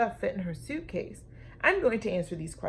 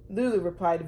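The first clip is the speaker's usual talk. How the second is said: ordinary delivery, nothing marked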